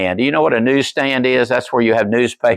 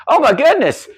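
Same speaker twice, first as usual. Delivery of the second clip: surprised; 13 semitones higher than usual. Retold or imitated. Pastor sees wife on magazine.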